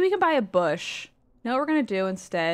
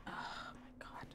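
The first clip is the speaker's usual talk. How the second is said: softer whispered my god; whispered exasperation; 23 dB quieter, faster than usual. Real, not acted. Streamer whispering over remaking the item. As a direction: breathe it out in despair.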